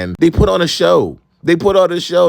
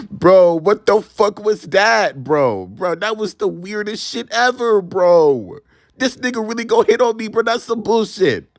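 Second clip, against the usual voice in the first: high, incredulous rant; outraged disbelief; 6 semitones higher than usual. Real, not acted. Telling viewers how gross the flirting was, incredulous. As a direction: laugh it off then vent. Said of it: "I found that extremely disrespectful".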